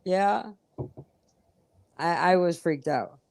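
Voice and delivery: normal talking voice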